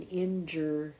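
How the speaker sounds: INJURED split slowly into in, jer, d; syllable by syllable. Shown as a demonstration. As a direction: sound out "injured" with the final D held apart. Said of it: "Here it is again".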